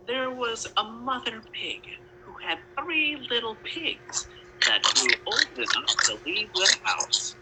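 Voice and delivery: ordinary delivery, nothing marked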